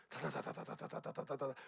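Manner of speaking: rattling rapid-fire da-da-da; imitated rapid repetition. Shown as a demonstration. Showing repeated simple labour speeding up, imitated rattle. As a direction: rattle it off like fast handwork. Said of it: "계속 반복하잖아요".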